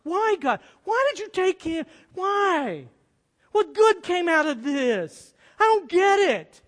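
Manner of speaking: anguished shout at heaven, half retold; retold anguished cry to God. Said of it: "I'm screaming out to God".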